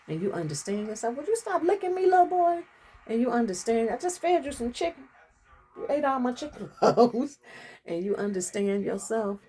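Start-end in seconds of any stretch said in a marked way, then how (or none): 1.2-2.6 high, sharp scolding of the dog; turning to scold the licking dog; talking to the dog. Real, not acted. Scolding dog mid-talk. 3.9-7.4 higher fond dog-talk with a laugh. Real, not acted. Talking to the dog.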